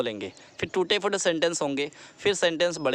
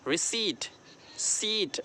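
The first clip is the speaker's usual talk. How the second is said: Receipt as ri-SEET, long second syllable; stress on सी; 3 semitones higher, 5 dB quieter, much slower than usual. Shown as a demonstration. Teacher showing where stress falls, ri-SEET. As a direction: stretch "see" in receipt, then सीट alone. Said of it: "री सी पर stress देंगे".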